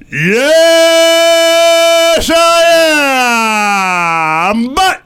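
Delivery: slow, high, drawn-out announcer voice. Acted. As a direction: stretch every word like a stadium announcer. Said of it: "my incredible announcer skills".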